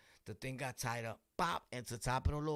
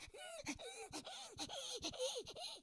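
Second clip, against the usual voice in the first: imitated dog whine; 9 dB quieter than usual. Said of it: "Your dog is like".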